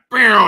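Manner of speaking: loud, high whoosh of dashing off; imitated zooming noise. Neighbour imitates himself racing out to the electrician, loud zooming noise. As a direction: make a big, high zooming sound.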